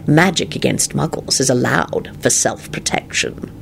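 slow, measured coldness; cold. A narrator's reading. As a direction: warn him coldly and slowly. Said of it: "he responded coldly".

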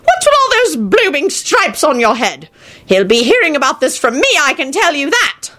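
put-on high-pitched scolding old man; old grandfather's high, indignant voice; crotchety old wizard.

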